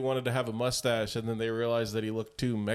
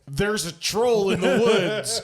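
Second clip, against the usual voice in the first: high, loud, slow holler; drawn-out imitated warning cry; mock dimwit alarm; 7 semitones higher, 6 dB louder, slower than usual. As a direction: holler it like a panicked fool. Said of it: "the stupidest person that you know".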